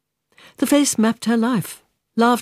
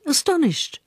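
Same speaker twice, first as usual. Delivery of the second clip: ordinary delivery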